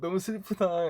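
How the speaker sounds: high-pitched retold lament; mock sadness. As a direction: imitate the manager getting sentimental. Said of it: "이러면서 얘기를 하고 있었다고". (높은 톤으로 슬픈 척 흉내)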